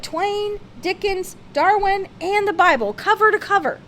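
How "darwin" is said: plain reading voice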